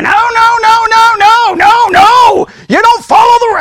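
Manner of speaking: high, loud string of objections; mock outraged protest. Pastor voicing email after email, hammed-up outraged shouting. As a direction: shout the refusals in rapid-fire imitation.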